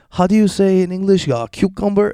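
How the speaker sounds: high, put-on fake Spanish accent; mock a fake foreigner asking for words; hammed-up fake Spanish accent, pitched high. Mocking fake Spanish-accented woman.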